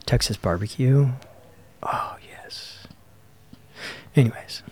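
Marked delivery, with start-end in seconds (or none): 1.8-2.8 whisper. Real, not acted. Savoring thought of barbecue.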